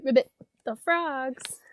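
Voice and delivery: ordinary delivery, nothing marked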